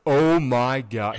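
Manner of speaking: retelling a friend's shocked reaction, slow and loud. Retold or imitated. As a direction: drag it out in disbelief. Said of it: "And he's like".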